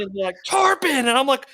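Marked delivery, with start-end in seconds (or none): 0.5-0.9 very high, loud imitated call; excited shout. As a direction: yell it out at top pitch. Retold guide's second shout.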